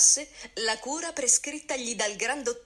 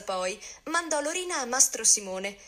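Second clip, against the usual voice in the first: ordinary delivery, nothing marked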